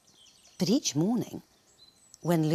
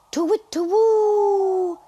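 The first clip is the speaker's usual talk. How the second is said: long, high, loud hooting; owl hoot; 9 dB louder, slower than usual. Acted. Narrator playing owls in the night, high drawn-out hoot. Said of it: "hooted the owls".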